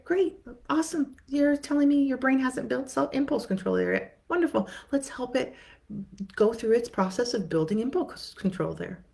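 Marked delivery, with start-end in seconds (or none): 0.0-7.5 high, bright cheering; upbeat cheer. Acted. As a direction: cheer the bad news on enthusiastically. Mock cheerful reply to client.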